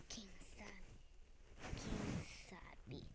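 breathy secret whisper. Pretend. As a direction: whisper it slyly. Repeated as whisper.

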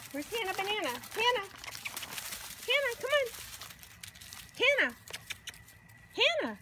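sing-song high call to the dogs. Real, not acted. Owner calling her dogs to come see, high and sing-song. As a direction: coax the dogs over in a high voice.

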